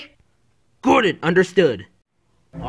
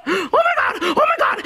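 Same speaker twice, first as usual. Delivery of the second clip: panicked shouting. Acted. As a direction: yell it in full panic. Luigi panics, left all alone.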